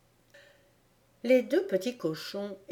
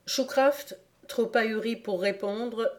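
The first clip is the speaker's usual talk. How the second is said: ordinary delivery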